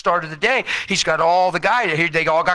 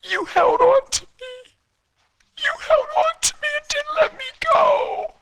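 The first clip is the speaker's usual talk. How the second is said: much higher, tearful voice of wife; high crying wife. Retold or imitated. Wife sobbing to husband that he never let go. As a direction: cry it out in her high voice. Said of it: "She said".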